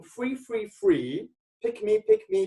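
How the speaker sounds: high, squeaky-pitched mimicry of hawkers; mock shouting. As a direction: imitate desperate touting in a very high voice. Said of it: "They were all shouting like that".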